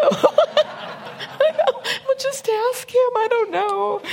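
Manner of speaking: very high, squeaky tearful voice; comic sobbing squeal with laughter; mock crying. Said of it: "So we're all crying".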